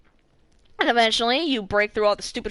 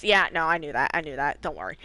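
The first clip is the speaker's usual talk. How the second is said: put-on lower, fading, mildly sheepish old man; sheepish Devrim; 7 semitones lower than usual. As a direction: mumble it lower and lower, covering up. Devrim pretending he knew.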